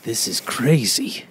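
low, slow, dramatic detective; slow deep Soper voice. Retold or imitated. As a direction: drag it out like a gumshoe. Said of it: "Soper's just like".

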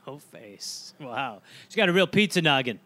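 ordinary delivery

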